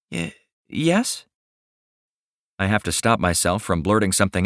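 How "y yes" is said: high unsure stammer; shy hesitant. Acted. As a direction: stammer it out shyly. Millie unsure, hesitant reply.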